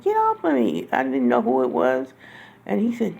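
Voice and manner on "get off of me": high-pitched cry to push him off; a retold cry of panic, not shouted; retold frantic plea. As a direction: relive the panic, pitch jumping up. Retold pushing off an attacker.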